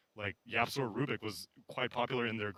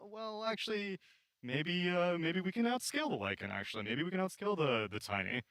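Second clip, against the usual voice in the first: higher, hesitant dithering voice; a hesitant team, higher and unsure; mock wavering drafter; 7 semitones higher than usual. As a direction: waffle mid-draft like an unsure player. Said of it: "you can't be like".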